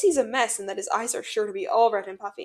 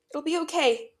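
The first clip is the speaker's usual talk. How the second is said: higher, feeble comfort; unsteady attempt at comforting; weak reassurance; 6 semitones higher than usual. A narrator's reading. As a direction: reassure weakly, pitch lifted. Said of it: "Mimic tells Scar weakly".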